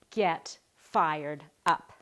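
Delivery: slow, punched get fired up; emphatic slow rallying call; rallying cry. Real, not acted. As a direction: drag out get fired up with a rising push. Rallying final message, slow and punched.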